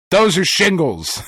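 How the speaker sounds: mock doctor, flat and disappointed; matter-of-fact doctor. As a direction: state it like it's obvious. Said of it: "he was just so matter-of-factly".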